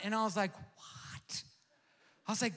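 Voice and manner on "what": breathless mock whisper of shock; stunned whisper. Pastor retelling the vet bill, whispering in shock. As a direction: mouth it in disbelief. Disbelief at vet's news.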